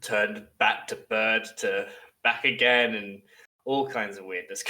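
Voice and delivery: usual speaking voice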